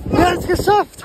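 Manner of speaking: triumphant shout, high; jubelnd. Real, not acted. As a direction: yell it out in triumph. Triumph after the swim. (jubelnder Schrei)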